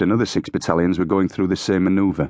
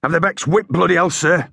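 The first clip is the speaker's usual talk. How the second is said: high-pitched parade-ground shout; sergeant's high, forceful bark; shouted report; 11 semitones higher, 3 dB louder than usual. A narrator's reading. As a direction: keep shouting at the officer. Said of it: "he shouted".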